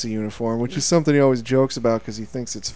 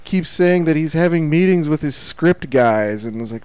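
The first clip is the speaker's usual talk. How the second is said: ordinary talk, nothing marked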